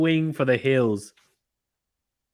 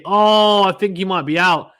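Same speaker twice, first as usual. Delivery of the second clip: sudden loud, higher burst; excited; 6 semitones higher, 8 dB louder than usual. Real, not acted. Commentator seeing a near run-out, sudden loud excitement. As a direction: gasp at the possible run-out. Close run-out chance, live.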